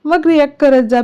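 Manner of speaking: ordinary delivery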